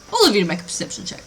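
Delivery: ordinary delivery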